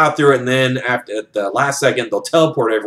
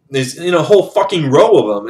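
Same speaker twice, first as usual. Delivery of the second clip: usual speaking voice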